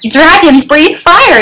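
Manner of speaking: slow, high mild yell; understated storybook coach's yell. A narrator's reading. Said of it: "yelled the coach".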